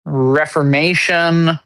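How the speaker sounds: ordinary talk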